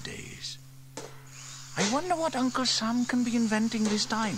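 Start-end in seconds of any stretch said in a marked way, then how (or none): 1.8-4.4 put-on high, bright-eyed girl wondering aloud; young girl Sarah, curious. A narrator's reading. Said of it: "said Sarah".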